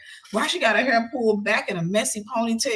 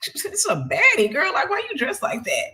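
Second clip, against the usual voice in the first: admiring inner thought; 8 semitones higher than usual. Retold or imitated. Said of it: "I'm like".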